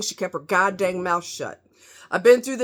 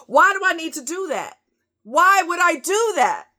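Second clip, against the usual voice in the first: indignant; 9 semitones higher, 5 dB louder than usual. Retold or imitated. Indignant at store security.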